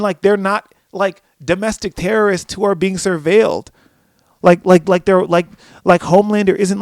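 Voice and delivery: high, loud incredulous rant; mock outrage. Viewer complaining about careless kids in Gen V, outraged. Retells own outraged reaction.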